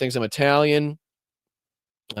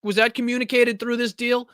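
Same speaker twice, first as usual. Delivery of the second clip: incredulous; 7 semitones higher than usual. Real, not acted. Host mocks clip, incredulous questioning.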